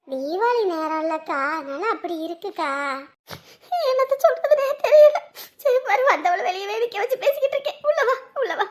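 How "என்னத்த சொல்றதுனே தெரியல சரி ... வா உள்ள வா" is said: high, sobbing helplessness; acted weepy distress; tearful despair. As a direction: welcome a guest while fighting tears. Weeping host greets a guest.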